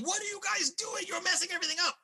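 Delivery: very high-pitched frantic yell; exasperated yelling. Acted. David on the ground scolding the fighters, yelling. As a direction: yell at them in a high, strained voice. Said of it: "starts yelling".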